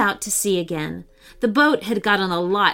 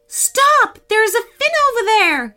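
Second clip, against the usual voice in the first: very high, loud excited cry; cat spotting a shark fin, shouting excitedly; excited exclamation; 13 semitones higher, 6 dB louder than usual. Acted. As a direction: shout out the sighting. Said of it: "she exclaimed excitedly".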